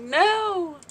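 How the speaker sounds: high-pitched, re-enacted excited no; excited retort. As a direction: squeal the retort, imitating your excited self. Retold answer in excited exchange.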